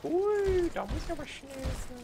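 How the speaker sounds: high, squeaky hurried creature voice; enemy's inner voice. Acted. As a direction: voice the panicked enemy. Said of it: "der denkt sich aber auch". (hohe quiekige Gegnerstimme)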